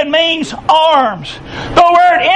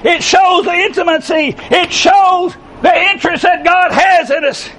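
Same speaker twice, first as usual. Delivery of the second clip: raised, fervent preaching voice; 3 semitones higher than usual. Real, not acted. Preacher rousing the congregation with fervent voice. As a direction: preach it out with full force.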